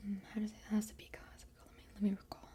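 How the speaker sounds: normal talking voice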